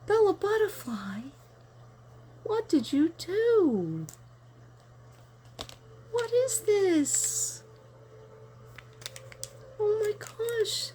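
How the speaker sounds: high, slow, breathless wonder; awed surprise. Real, not acted. Opening a gift from Bella Butterfly, slow awed wonder. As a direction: draw it out in awe as you open the gift. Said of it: "just got the chills".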